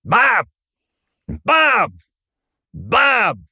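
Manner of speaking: harsh, high swooping call of a name; imitated belittling wife's summons; dismissive, derogatory. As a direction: snap the name like scolding a pet. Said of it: "the tone of how she said his name".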